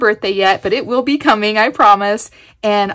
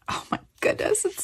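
awed whisper; 10 semitones higher, 9 dB quieter, faster than usual. Real, not acted. Marvelling over a handmade gift.

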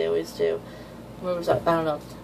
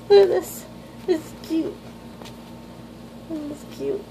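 much higher cutesy squeal; gushing squeal; 10 semitones higher, slower than usual. Real, not acted. Fan showing the cute panel, high squeal. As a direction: squeal with delight at the page.

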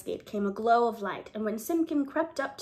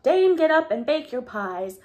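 crowing cocks; 4 semitones higher, 7 dB louder than usual. Acted. Cocks crying out in story.

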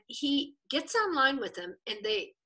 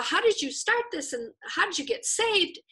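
eager; 8 semitones higher than usual. Retold or imitated. Eager rapid questions.

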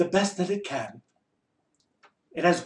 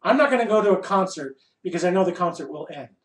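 ordinary talk, nothing marked

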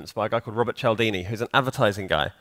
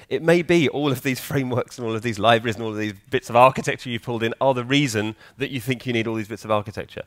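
ordinary talk, nothing marked

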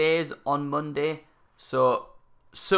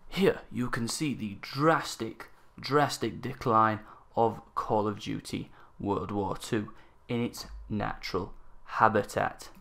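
nature documentary; 3 semitones lower, 6 dB quieter than usual. Pretend. Mock wildlife narration over footage.

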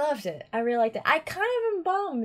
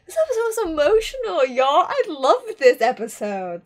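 excited gushing; 6 semitones higher, 8 dB louder than usual. Real, not acted. Gushing about the episode.

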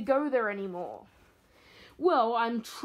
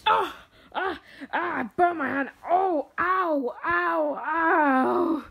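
pained yelps; 5 semitones higher, 4 dB louder, slower than usual. Acted. Doctor burns his hand.

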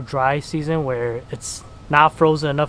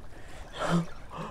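sharp excited gasp; 4 semitones higher, 12 dB quieter than usual. Real, not acted. Big fish surfacing.

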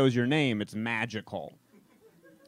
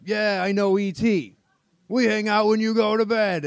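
cool dad; 8 semitones higher, 4 dB louder than usual. Retold or imitated. Dad bragging to grown son about E.T., smug. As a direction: play it off smooth. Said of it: "And my dad's like".

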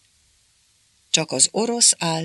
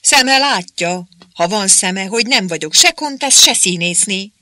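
indignant; 5 semitones higher, 5 dB louder than usual. A narrator's reading. Márika snapping at interpreter.

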